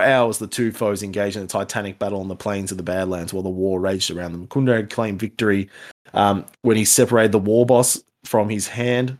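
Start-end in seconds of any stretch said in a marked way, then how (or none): none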